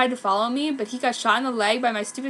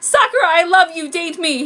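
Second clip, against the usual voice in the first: higher, louder mock girly voice; stupid girl voice; 7 semitones higher, 10 dB louder than usual. Prep boy confessing love in a mocking girly voice. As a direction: plead it in a silly girlish voice. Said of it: "in his stupid girl voice".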